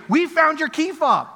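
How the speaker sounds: high, loud, drawn-out call; singsong "look what we've got" shout; mock triumphant announcement. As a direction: imitate pranksters crowing over their find. Pranksters announce their find to him.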